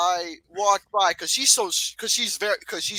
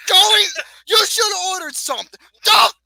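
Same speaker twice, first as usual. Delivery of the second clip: high, loud whining protest; whiny shout; 8 semitones higher, 7 dB louder than usual. Real, not acted. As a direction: cry out to save your food. Family member takes his wing.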